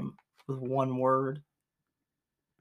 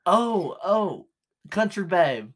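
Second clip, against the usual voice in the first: excited realization; 5 semitones higher, 5 dB louder, slower than usual. Real, not acted. Sudden realization of answer.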